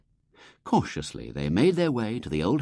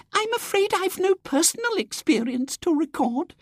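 put-on much higher, fluttery little old lady; Miss Simpke. Miss Simpke apologising to the society, fluttery old lady. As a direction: twitter apologetically.

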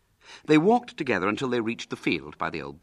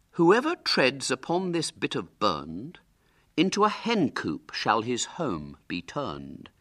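slow, high recited curse; spell incantation; 7 semitones higher, 4 dB louder than usual. A narrator's reading. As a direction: intone it slowly like a rhyming spell.

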